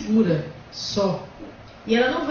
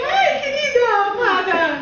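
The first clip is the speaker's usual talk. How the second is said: much higher, louder mock feminine voice; mock falsetto; 12 semitones higher, 7 dB louder than usual. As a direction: squeal it in a fake girly voice. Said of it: "Fala com ela assim". (voz fina e exagerada de deboche, imitando mulher)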